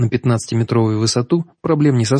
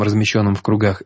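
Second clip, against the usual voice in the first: ordinary delivery, nothing marked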